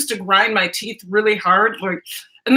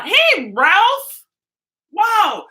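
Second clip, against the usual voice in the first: excited greeting; 10 semitones higher, 3 dB louder, much slower than usual. Real, not acted. Greeting a chat viewer.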